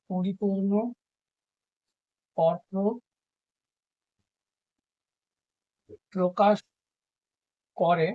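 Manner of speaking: পরিপূর্ণ অর্থ প্রকাশ করে said one word at a time with long gaps; slow dictated phrase; dictation. Shown as a demonstration. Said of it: "dictated one word at a time".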